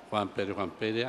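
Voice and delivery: absent-minded low murmur; muttered list-checking. Acted. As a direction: repeat the name under your breath as you search. Devil searches his list.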